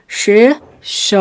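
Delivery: शेष split into a long शे and a separate ष; drawn-out शे, pause, hissed ष; word broken up. Shown as a demonstration. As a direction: break शेष in two, very slowly. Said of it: "थोड़ा सा तोड़िए".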